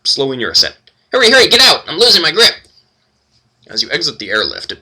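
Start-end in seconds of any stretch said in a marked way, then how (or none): 1.1-2.5 loud, high, frantic gnome cry; panicked gnome. Acted. The gnome hurries the party out of the lift, panicked yell. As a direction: yell like you're about to lose your grip.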